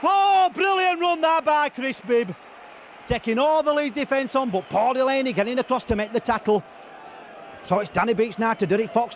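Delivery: excited rugby commentary, rising; live play-by-play. Real, not acted. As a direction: call the match live.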